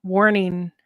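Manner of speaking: usual speaking voice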